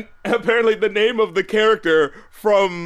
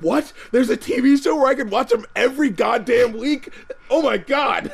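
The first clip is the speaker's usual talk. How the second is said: higher-pitched incredulous fan reaction; retold excitement; 6 semitones higher than usual. Fan learns of weekly Jackie Chan show, reenacted excitement. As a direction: act out amazed fan glee, pitched up. Acts out younger self's reaction.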